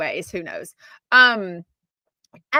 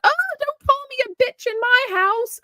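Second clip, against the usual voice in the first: high, indignant mock of Dorit. Vlogger mimics Dorit scolding Sutton, high indignant impression. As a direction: jump way up, offended and precious. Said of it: "And then Dorit's like".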